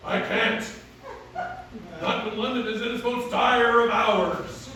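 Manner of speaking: loud, declaimed mock-archaic stage voice; resolute masked hero. Hero telling Selina he must stay for London, declaimed. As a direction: declaim it like a hero refusing love. Acts the hero of his play.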